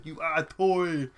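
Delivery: slow, loud Austrian Arnold accent; Arnold impression. Shown as a demonstration. Shows the Arnold game, slow Austrian accent. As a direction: punch each word like the Terminator. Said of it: "Imagine Arnold in another film".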